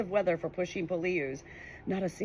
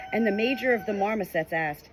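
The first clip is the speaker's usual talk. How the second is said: ordinary delivery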